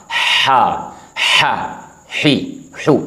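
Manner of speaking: हा breathed from the throat, not खो; letter drill. Shown as a demonstration. Teacher showing the sound of हा to students. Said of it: "तो इसकी आवाज़ हम समझें हा".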